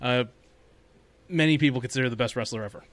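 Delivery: ordinary delivery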